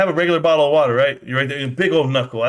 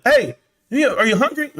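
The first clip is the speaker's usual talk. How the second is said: higher-pitched mock wifely nagging; imitated interrupting wife; 7 semitones higher than usual. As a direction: call over in a put-on higher voice. Voices a wife interrupting the viewer.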